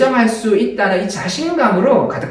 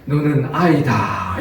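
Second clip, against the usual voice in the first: slow inner voice; 6 semitones lower, much slower than usual. Retold or imitated. God's inner voice quoted.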